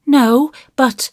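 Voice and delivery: put-on high, hesitant child's voice; careful little-boy qualification; cautious. A narrator's reading. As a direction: hedge it like a wary small boy. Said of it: "added Davy cautiously".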